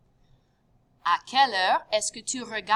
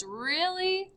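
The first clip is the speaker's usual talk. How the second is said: high, drawn-out doubt; unsure, questioning; 9 semitones higher than usual. Shown as a demonstration. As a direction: query their reasoning with a rising voice. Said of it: "you're questioning their thought process".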